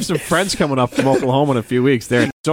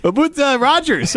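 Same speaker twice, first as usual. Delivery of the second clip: imitating a Canadian radio host, higher and laughing; half-laughing Canadian host impression; mock Canadian accent; 11 semitones higher than usual. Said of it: "they have a little bit of".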